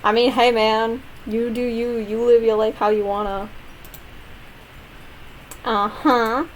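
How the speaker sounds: ordinary talk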